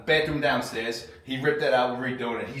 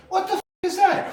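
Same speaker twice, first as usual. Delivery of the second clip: much higher, louder startled yell of confusion; baffled shout; 4 dB louder than usual. Retold or imitated. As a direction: retell his alarmed, baffled shout. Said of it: "and he goes".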